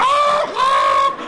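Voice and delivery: very high, loud oh oh; alarmed cries. Retold or imitated. Teller reliving the coon fighting the dogs, high loud cries. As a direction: cry out at the fight.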